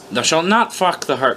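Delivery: usual speaking voice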